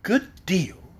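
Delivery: a touch of seriousness; understated serious girl; graver. A narrator's reading. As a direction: answer a little gravely. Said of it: "said the girl in a graver tone".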